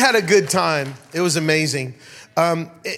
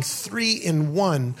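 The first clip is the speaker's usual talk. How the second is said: slowly pressing three in one; slow insistent repeat; much slower than usual. Retold or imitated. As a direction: drag it out, insisting. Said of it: "He kept saying".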